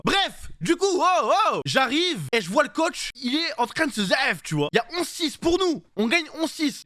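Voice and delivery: voix nettement plus haute, emballée; récit excité. Real, not acted. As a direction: relance l'histoire avec emphase, haut perché. Relance animée de son récit. (récit emballé, voix haute)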